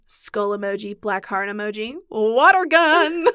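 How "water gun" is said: deflated punchline. Retold or imitated. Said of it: "It's such a tone shift every time".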